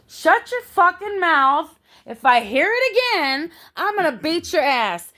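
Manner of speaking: high, loud mock threat; acted-out angry yelling. Mean cellmate threatening her over advice, retold angry yelling. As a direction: imitate a furious cellmate laying down a threat. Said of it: "hear her yell at me".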